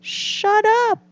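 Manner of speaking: very high mock scream. A narrator's reading. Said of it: "screamed Toad".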